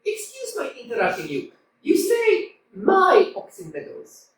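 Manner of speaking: ordinary talk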